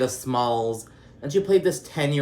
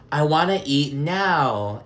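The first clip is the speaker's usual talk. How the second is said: slow, higher-pitched imitation of a child's demand; imitated little boy insisting; mock demanding kid; 5 semitones higher, 3 dB louder, slower than usual. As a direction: drag it out like a pestering kid. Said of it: "kind of annoying or whatever".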